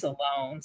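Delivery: "Salone" with an extra vowel after the S; Sloan in two syllables. Real, not acted. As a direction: split "Salone" into suh-LONE. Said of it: "you put an A in there".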